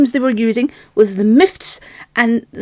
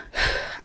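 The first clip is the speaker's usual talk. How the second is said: long breathy exasperated sigh; heavy sigh; 10 dB quieter than usual. Real, not acted. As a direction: blow the air out. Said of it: "I'm actually out of breath".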